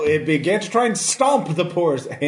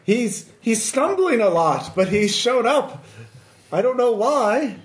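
high, slowed, fretful villager voice; a frightened little puppet bursting in with news; panicky villager puppet; 7 semitones higher than usual. Acted. Townsfolk puppet warning, high voice.

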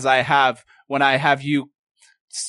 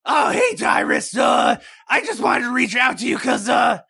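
shouting aloud; 9 semitones higher than usual. Acted. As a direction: yell it out before switching to telepathy. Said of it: "instead of talking like this".

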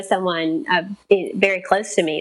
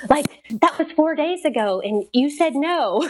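incredulous; 4 semitones higher than usual. Retold or imitated. Stunned at birth mother's return.

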